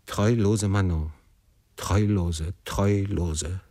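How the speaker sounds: plain reading voice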